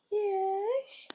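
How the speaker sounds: high breathy whisper for the dog; dog's voice. Pretend. Girl voicing her dog's reply in a whisper. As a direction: answer for the dog in a tiny whisper.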